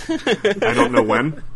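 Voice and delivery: high, slow giggly I don't know when; laughing. Real, not acted. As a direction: giggle the words out. Laughing answer about notes.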